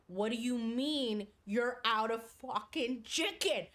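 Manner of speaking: slow, emphatic mock outrage; imitated meltdown. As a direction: draw out each word in retold disbelief. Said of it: "Starts losing it".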